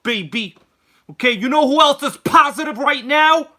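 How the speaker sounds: loud, high, pumped-up stream intro; hyped-up opening. Acted. Host opening his live stream, loud and pumped up. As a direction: blast the energy like a hype man. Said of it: "Let me, uh, calm down here a little bit".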